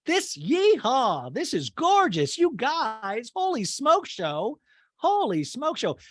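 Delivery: high, slow, gushing put-on voice; hammed-up Kyle gushing over the rental; Kyle impression. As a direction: rave about the house in a drawn-out high coo. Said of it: "And Kyle's like".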